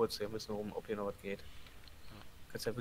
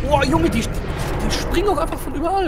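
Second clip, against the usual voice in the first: high, loud frantic yell; panicked shout; 13 semitones higher, 14 dB louder than usual. Real, not acted. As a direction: yell as enemies swarm. Enemies jumping from everywhere.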